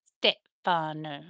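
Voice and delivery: "Stefano" broken slowly into syllables; careful name attempt. Shown as a demonstration. Streamer unsure of the accent, "Stefano" slow and careful. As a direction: sound out "Stefano" slow and low, piece by piece. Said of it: "putting the accent in the wrong spot".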